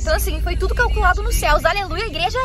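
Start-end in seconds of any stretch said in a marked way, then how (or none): 1.6-2.4 church cheer. Pretend. Playful church cheer on arrival.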